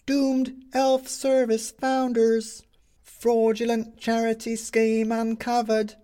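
high, slow headline cry; newsboy calling headlines. Acted.

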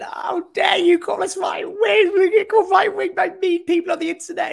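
high squealing mock outrage, shouted; mock outraged Blairite. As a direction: lampoon a shrieking offended MP. Mocking Labour right's outrage.

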